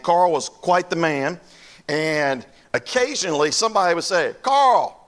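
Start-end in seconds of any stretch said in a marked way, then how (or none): 4.4-4.9 loud, high call of a name. Retold or imitated. Churchgoer hailing old Carl for a war story, calling out. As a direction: holler across to someone.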